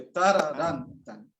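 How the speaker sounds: put-on heavy accent on the opening "ta" of "tararán"; accent on first note. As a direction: imitate the student hitting the first note of "tararán". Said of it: "escuché mucho acento en la primer nota".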